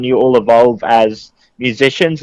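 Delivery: ordinary delivery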